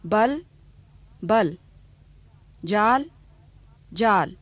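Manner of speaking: बल with short अ against जाल with long आ, each twice; short and long vowel. Shown as a demonstration. Teacher giving examples before the drill. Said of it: "इन्हें ध्यान से सुनिए".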